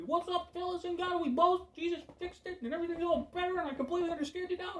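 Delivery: hammed-up sunny voice, racing at the end; fake cheery preacher. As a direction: play a grinning feel-good pastor who has it all figured out. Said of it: "I might be".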